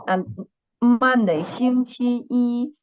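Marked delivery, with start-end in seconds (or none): 1.4-2.7 slow 星期一 with 一 high and level; first tone on 一. Shown as a demonstration. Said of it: "the first tone".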